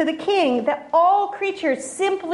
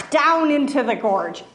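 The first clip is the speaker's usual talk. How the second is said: ordinary delivery, nothing marked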